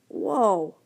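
plain reading voice

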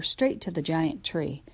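usual speaking voice

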